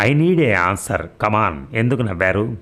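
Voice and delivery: ordinary delivery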